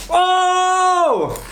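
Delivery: high surprised yelp; sudden startled cry; surprised shout. Real, not acted. Ducky flies out window.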